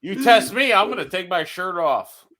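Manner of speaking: taunting Bert Kreischer, laughing mock threat. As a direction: threaten him while cracking up.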